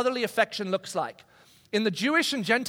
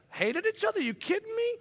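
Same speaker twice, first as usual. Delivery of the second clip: mock indignation; 12 semitones higher, 4 dB quieter than usual. Siblings answer the outsider.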